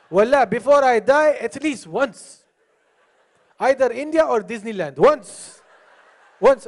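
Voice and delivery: hammed-up longing, punched out loud; mock fervent wish. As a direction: exaggerate an earnest pledge. Mimics audience member's wish to travel.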